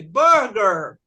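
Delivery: "burger" slow and drawn out; model pronunciation. Shown as a demonstration. Teacher models a word for the class to repeat, slow pronunciation. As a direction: say "burger" slowly for pupils to repeat.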